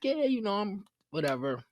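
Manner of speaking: playing it off. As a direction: brush it off as if no big deal. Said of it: "And I'm like".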